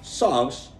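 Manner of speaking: ordinary talk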